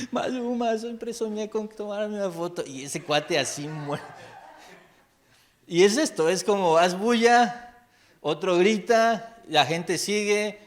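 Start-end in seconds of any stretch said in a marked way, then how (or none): 0.1-2.6 high, crying fan voice; tearful fan meeting her idol, voice pitched up; mock tearful fan. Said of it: "llorando así de". 5.7-10.6 loud, higher and slow; preacher pressing the lesson, slow and loud; forceful preaching. Acted. Preacher driving the point home.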